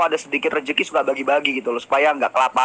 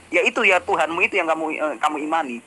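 ordinary talk, nothing marked

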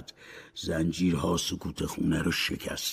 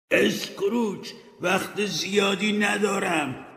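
high, slow urgent shout; ghost's shout; 11 semitones higher than usual. Acted. Ghost Marley shouts to Scrooge that time is short. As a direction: call out the warning with urgency. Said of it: "روح مارلی فریاد زد".

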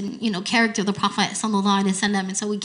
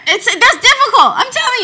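laughing; 12 semitones higher, 7 dB louder than usual. Real, not acted. Laughing admission.

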